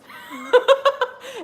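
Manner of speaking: high loud burst of laughter. Real, not acted. Laughing at her own fight-the-demons line, loud and high. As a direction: let a big laugh out.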